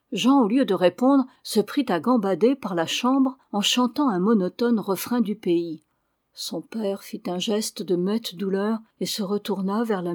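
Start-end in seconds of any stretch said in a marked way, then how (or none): none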